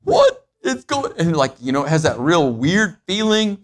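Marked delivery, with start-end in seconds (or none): 0.0-1.1 sudden high squeak of alarm, then slower; startled waking. Retold or imitated. Sleeper finds their hand dead, high startled yelp. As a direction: jolt awake and yelp at your numb hand. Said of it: "and then you're like".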